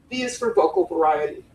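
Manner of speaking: usual speaking voice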